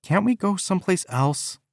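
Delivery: high, pleading whine; whiny. A narrator's reading. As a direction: whine to get her way. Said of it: "That telltale whine".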